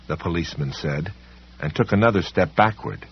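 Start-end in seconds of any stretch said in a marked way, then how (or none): none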